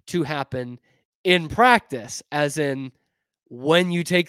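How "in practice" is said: in practice, slow, practice pressed hard; stress on practice. Shown as a demonstration. Telling the football coach what he wants, practice stressed. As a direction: drag out practice and lean on it. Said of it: "I'm gonna put emphasis on the word practice".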